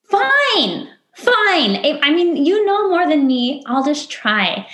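high, whiny reluctant giving in; grudging surrender. Retold or imitated. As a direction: give up with a sulky sigh. Retold grudging give-in.

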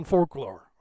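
"folklore" slipping out as "forklore"; accent slip. Real, not acted. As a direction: say "folklore" with an R in place of the L, "fork-lore". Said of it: "sorry, I said folklore. It was an accent".

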